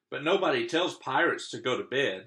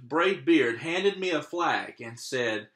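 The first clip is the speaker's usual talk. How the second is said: ordinary delivery, nothing marked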